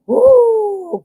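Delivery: high whooping cheer; excited woo. Real, not acted. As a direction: cheer them on.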